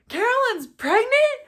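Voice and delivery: high, loud, disbelieving grandpa; sudden realization. Retold or imitated. Grandpa finally realizing, high astonished question. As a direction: blurt it out in shock. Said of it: "he comes over and goes".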